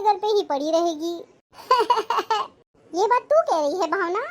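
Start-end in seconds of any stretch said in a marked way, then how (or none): 1.7-2.5 a short amused laugh, a bit higher; mother laughing. Acted. Mother laughs at her worried daughter's complaint. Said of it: "आप ऐसे क्यों हंस रही हो".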